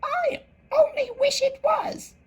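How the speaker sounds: very high March Hare voice, not whispered. A narrator's reading. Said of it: "in a whisper".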